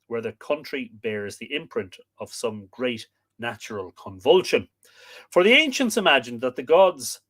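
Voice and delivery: lively read-aloud storytelling voice; story narration. A narrator's reading. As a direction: tell the myth to the audience. Reads myth narration aloud.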